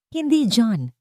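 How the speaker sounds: firm, steady refusal. A narrator's reading. Aya firmly stopping John from facing Bianca alone, steady. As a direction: cut him off calmly but firmly. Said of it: "Matatag na sabi ni Aya".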